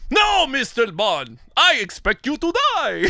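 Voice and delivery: hammed-up villain, drawn out, climbing to a high finish; Goldfinger. Co-host voicing the big fat villain, hammed-up gloating. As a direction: proclaim it like a gloating movie villain. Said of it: "then the big fat guy, Goldfinger".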